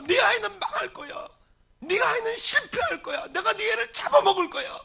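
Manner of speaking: high, loud desperate wail; anguished screaming prayer. Acted. Missionary parent telling God of a collapsing son, anguished screaming. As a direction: pour out despair at full pitch. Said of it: "선교사님이 그렇게 절규했을 것 같아서".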